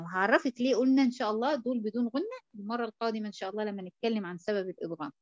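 normal talking voice

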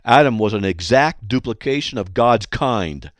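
ordinary talk

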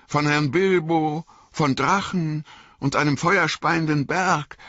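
high-pitched, earnest simple servant confessing; Sam, sheepish but eager. Acted. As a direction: own up shyly, then warm to the wonder.

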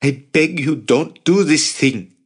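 high, desperate drawn-out appeal; pleading. A narrator's reading. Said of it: "Jacob pleaded".

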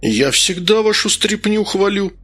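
high-pitched, hurt protest; offended. A narrator's reading. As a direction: protest as if wounded. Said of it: "обиделся Медовар".

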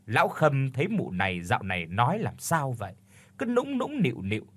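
ordinary delivery

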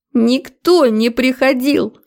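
high, drawn-out female protest; hysterical cry. A narrator's reading. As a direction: wail the denial hysterically. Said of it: "истерично завопила Светка".

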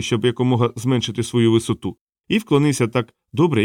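usual speaking voice

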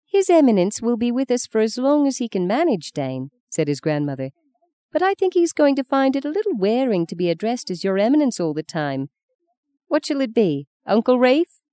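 high, lilting grandmotherly voice; grandmother hosting a guest. Acted. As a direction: speak for the guest to your grandson. Said his grandmother.

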